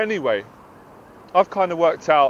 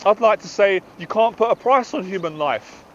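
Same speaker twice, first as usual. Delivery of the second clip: ordinary talk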